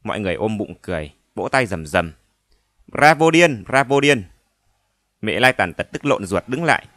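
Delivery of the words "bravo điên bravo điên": loud cheering crowd cry; cheering shout. Acted.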